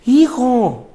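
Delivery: high, loud emotional call of a father; a father's sudden loud cry. Retold or imitated. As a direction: cry out the son's name in shock. Father's cry on seeing son.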